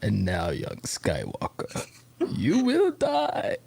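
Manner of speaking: menacing Sith lord voice; Emperor Palpatine. Pretend. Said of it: "program mine to, like, to say".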